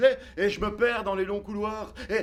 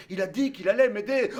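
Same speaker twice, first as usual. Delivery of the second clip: ordinary talk, nothing marked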